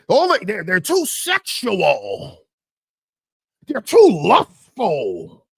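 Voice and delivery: hammed-up tirade, high start sinking into drawn-out words; mocking tirade. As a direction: mimic outraged critics, stretching the last words. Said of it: "y'all wanna go on a [name] tirade".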